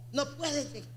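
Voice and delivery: a soft, sinking echo of the line. Real, not acted. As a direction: drop to a hushed repeat. Repeated phrase, second fades.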